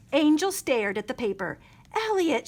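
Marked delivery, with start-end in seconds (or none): 1.9-2.5 high urgent call of his name; cried out. A narrator's reading. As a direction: stop him with his name. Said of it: "she cried".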